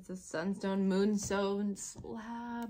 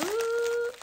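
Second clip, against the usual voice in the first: excited squeal; 7 dB louder than usual. Real, not acted. Opening a new crystal.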